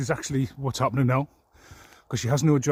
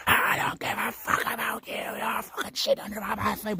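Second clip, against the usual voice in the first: mock screech, much higher, softer; imitating her outburst; 9 semitones higher, 4 dB quieter than usual. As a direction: parody the sudden switch from calm to rage. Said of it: "she went from calm to".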